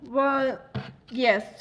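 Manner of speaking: normal talking voice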